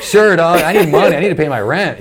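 high, loud, laughing sarcastic agreement; laughing mock reply. Comic mocking his broke self to bookers, loud laughing.

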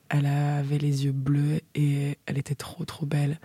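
dreamy unvoiced whisper. Real, not acted. Whispering how beautiful Lisa was. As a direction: breathe it out admiringly.

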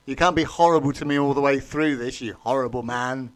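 normal talking voice

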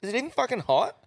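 imitating an outraged customer; mock angry woman. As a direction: mimic her grilling the staff. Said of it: "she goes".

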